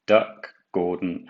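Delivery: ordinary delivery, nothing marked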